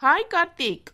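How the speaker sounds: high-pitched, eager hello; eager greeting. Acted. Bride spots her groom arriving, eager high greeting. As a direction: greet your fiancé with a lifted voice.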